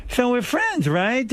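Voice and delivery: high-pitched mock friendly appeal; mocking imitation. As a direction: mimic someone fishing for friendship. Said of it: "he said to me".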